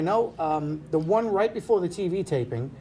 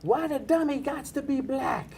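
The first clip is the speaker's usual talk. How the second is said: high, slow yelled heckle from the balcony; heckling woman; 10 semitones higher, slower than usual. Retold or imitated. Woman in the balcony yelling at the ventriloquist, high and slow. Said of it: "I hear this female voice, and she yells out".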